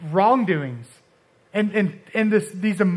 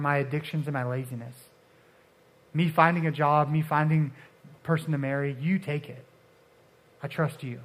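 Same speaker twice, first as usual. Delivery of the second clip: quiet, earnest handing it over to God; soft surrendering prayer; 4 semitones lower, 5 dB quieter than usual. Retold or imitated. Preacher voices a surrendering prayer to God, soft and earnest. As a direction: pray it softly, letting go.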